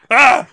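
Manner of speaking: high, loud mummy shriek; mummy scream. Retold or imitated. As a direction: belt out a sudden mummy wail. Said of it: "The mummy going".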